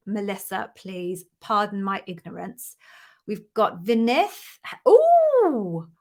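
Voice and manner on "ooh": long high, loud "ooh" that falls away; delighted swooping ooh; excited ooh. Real, not acted. Host spotting a viewer's news in the chat, excited ooh.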